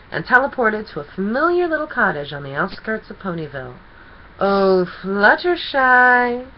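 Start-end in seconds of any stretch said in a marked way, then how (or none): 4.4-6.5 loud, slow, sing-song call. A narrator's reading. Discord calls to Fluttershy at her cottage, loud and drawn out. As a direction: call her name across the yard. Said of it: "he called out".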